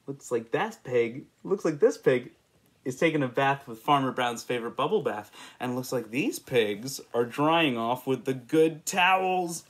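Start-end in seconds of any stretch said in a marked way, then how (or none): none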